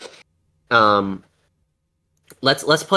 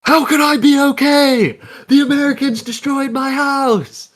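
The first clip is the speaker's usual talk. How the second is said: high, wailing mock anguish; much higher hammed-up pained voice; mock anguished Iraqi; 13 semitones higher than usual. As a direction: overplay the suffering in a high voice. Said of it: "they were like".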